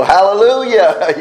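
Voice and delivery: high, loud celebratory shout of praise; exultant praise. Pretend. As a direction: call it out like a jubilant congregant. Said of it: "stand up and go".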